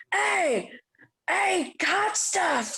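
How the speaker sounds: put-on high, slurred drunk calling out; drunk heckler. Drunk man at the bar hollering for her attention. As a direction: call out like a sloppy drunk at a bar.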